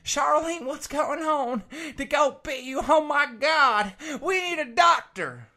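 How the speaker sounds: high, loud, crying panic for his wife; panicked husband. Acted. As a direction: wail her name in terror. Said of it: "he's like".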